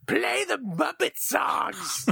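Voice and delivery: very high, stretched-out mock holler; strained heckler cry over a crowd; heckler yelling. Fan jumping in as the song ends, mock yell. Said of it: "be that guy yelling out".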